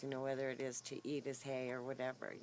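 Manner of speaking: ordinary talk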